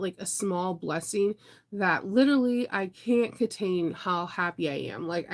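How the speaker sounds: usual speaking voice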